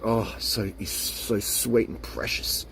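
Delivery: slow, soft, half-whispered adoration; breathy swooning. Pretend. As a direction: melt as you say it. Continues gushing lover voice.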